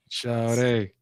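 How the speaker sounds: light imitation of an Atlanta guy; imitated catcall. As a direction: toss out a mock catcall, softly. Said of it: "This is what they're doing in Atlanta".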